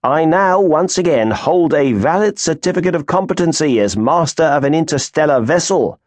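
smug captain showing off. Acted. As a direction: gloat over the news. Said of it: "he said smugly".

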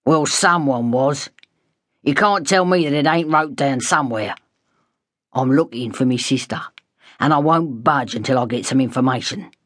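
lightly voiced rough cockney, irritated; a hint of a gruff working man losing patience; irate cockney man. A narrator's reading. Angry man demanding answers from the hospital clerk, lightly voiced rough cockney. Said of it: "an irate man".

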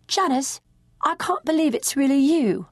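high, amazed disbelief; ex-lover stunned to see her pregnant, amazed; amazed greeting. Acted. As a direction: greet her as if you can't believe your eyes. Stunned rock star greets her.

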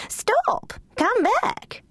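very high mock cry after the dog. Duchess crying after Lulu Bell, high. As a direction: call her back in alarm. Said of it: "the Duchess had cried".

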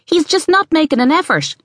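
high-voiced cheerful boy; cheery calling back. A narrator's reading. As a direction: call back brightly as a child at play. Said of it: "Mark called back cheerily".

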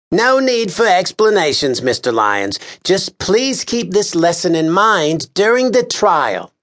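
high, officious, exasperated voice; pompous official scolding a juror; exasperated Lars Kern. A narrator's reading. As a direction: lecture the juror with weary disdain. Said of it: "said Mister Kern".

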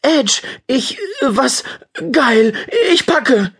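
can't finish a sentence for joy; boy thrilled, rushing off to pack; overexcited. Acted. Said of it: "vor lauter Aufregung außerstande".

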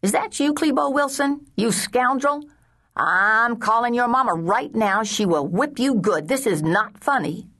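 high, nasal scolding; cranky woman's high nasal telling-off; Miss Betty Ramsey. A narrator's reading. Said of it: "Miss Betty's voice was high and nasal".

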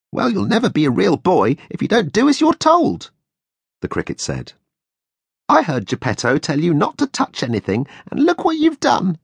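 high tiny voice, scolding, rising sharper; scolding cricket. A narrator's reading. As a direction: tell off the disobedient puppet.

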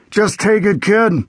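put-on high, slow growl of an old sheriff. A narrator's reading. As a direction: growl it out like a grumpy lawman. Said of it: "he growled".

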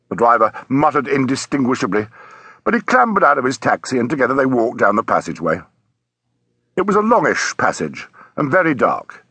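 plain reading voice

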